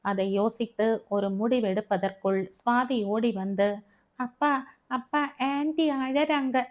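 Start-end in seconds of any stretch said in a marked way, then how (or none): 4.2-6.6 put-on high, upset little child calling Dad; small child voice, pitched up; halting child. A narrator's reading. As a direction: stammer it out like a worried kid. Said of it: "திக்கி திக்கி சொன்னதை".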